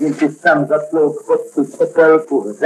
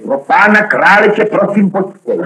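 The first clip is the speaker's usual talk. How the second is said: alarmed plea; 6 semitones higher than usual. Acted. Friend begs the executioner to wait, alarmed and pleading. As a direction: beg him to stop, aghast.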